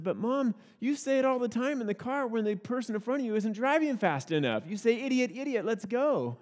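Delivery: lightly voiced kid calling out Mom; little girl protesting. Child protesting to mom, retold.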